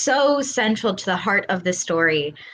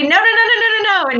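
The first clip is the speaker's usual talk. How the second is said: higher, louder string of no's; imitating a stern director; 11 semitones higher, 4 dB louder, faster than usual. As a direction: wave people back with a higher, louder no. Director warns actors to keep distance.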